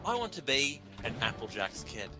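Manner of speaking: ordinary talk, nothing marked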